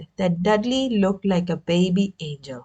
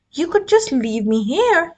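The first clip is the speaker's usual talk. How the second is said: hopefully; 8 semitones higher than usual. A narrator's reading. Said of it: "Harry put in hopefully".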